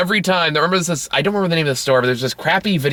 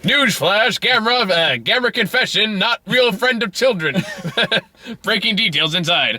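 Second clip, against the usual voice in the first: news flash announcer; 6 semitones higher than usual. Retold or imitated. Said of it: "It goes".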